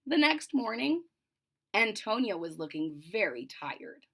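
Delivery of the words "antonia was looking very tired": plain reading voice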